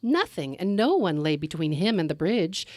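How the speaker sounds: usual speaking voice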